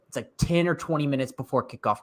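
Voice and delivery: ordinary talk, nothing marked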